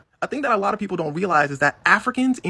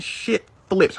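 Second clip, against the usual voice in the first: normal talking voice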